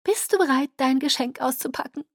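high, sweetly piped question; fluting. A narrator's reading. Alice offers Bella her present, fluting. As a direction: flute it in a lifted, coaxing voice. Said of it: "flötete sie".

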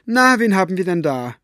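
high, ringing call that falls away. A narrator's reading. Elinor spots a visitor from her window, calling out. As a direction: hail someone from a distance. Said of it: "schallte Elinors Stimme vom Haus herüber".

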